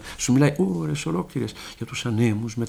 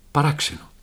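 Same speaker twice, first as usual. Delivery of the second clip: plain reading voice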